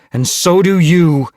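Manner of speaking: loud, high, forceful insistence; growled. A narrator's reading. Mothwing tells Lizardtail where he belongs, growling. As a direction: drive it home angrily. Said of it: "Mothwing growled".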